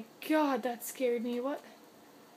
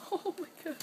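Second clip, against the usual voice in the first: ordinary talk, nothing marked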